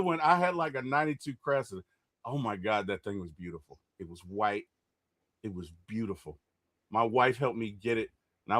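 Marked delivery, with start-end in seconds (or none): 2.2-6.4 softer, lower, savoring each word; hushed admiration. Real, not acted. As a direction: sigh over the beautiful car.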